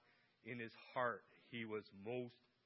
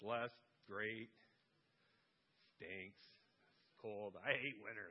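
softly grumbling list, trailing lower; grumbling go-to answers; 7 semitones lower, 6 dB quieter, slower than usual. Shown as a demonstration. Pastor showing the congregation her own grumbling, mock grumbling. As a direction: mutter the complaints. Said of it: "what's your go-to thought?".

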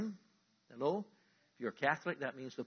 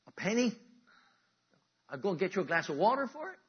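higher, louder mock bargaining; mock haggler; 6 semitones higher, 8 dB louder than usual. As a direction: ham up a cheap haggler's offers. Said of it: "he says".

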